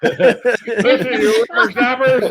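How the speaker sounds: put-on high, loud old geezer scold; old man voice. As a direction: scold the kids like an old-timer. Joins old man bit.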